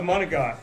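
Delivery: "monagot" with a G, no final vowel; Italian-American pronunciation. Retold or imitated. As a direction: say "monagot" for manicotti, ending on the T. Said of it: "things that people say wrong".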